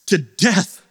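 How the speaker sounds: ordinary delivery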